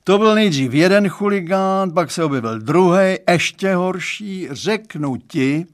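exasperated father. A narrator's reading. Father recounting daughter's earlier boyfriends, exasperated. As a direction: complain emphatically, dragging out the end.

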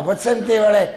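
put-on high, loud drunken yell across the bar; drunk shouting. Second drunk hollers at his mate, high and loud. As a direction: bellow it like a tipsy barfly.

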